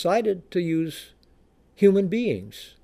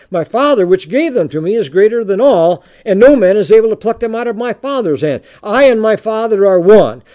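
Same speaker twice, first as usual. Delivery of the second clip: booming scripture reading; 2 semitones higher, 7 dB louder than usual. Acted. Reads scripture aloud.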